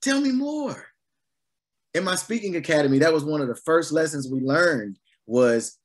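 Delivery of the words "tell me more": very high-pitched, hammed-up begging for more; suddenly eager. Preacher mimicking people hooked and wanting more, suddenly eager. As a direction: squeal with mock eagerness to hear it. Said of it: "Their whole attitude changes".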